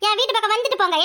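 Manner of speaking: normal talking voice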